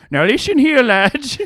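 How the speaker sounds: put-on high, loud stage Irish accent; Irish barman addressing the lads; hammed-up Irish brogue; mock Irish accent. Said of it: "they have an Irish accent".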